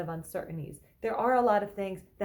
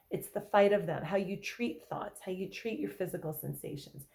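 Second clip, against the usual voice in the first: ordinary talk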